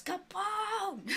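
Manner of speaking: high-pitched call across the room; yell. Acted. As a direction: yell out for the boss, drawn out. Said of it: "um, and yells".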